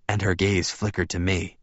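ordinary delivery, nothing marked

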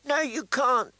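put-on high-pitched, insistent angry little boy; angry child. A narrator's reading. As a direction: refuse to accept it, childishly angry. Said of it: "said Rickon angrily".

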